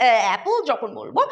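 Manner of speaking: ordinary delivery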